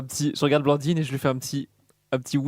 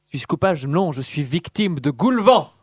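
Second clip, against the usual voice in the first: hammy stage actor. Acted. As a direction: declaim like a hammy tragedian. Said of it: "je vais déclamer un poème".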